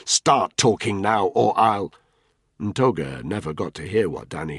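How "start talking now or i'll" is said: slow, loud, furious demand; angry threat. A narrator's reading. Danny threatening Ntoga at gunpoint, furious. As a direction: lose your temper.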